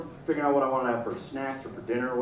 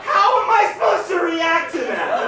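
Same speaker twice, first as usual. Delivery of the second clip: incredulous shout; 13 semitones higher, 6 dB louder than usual. Real, not acted.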